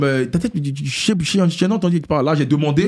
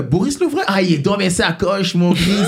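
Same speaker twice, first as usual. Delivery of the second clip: high, drawn-out imitation of a fan; hyped-up fan; 4 semitones higher, slower than usual. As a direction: mimic a fan bragging, pitched up and stretched out. Imitating fan praising him unknowingly.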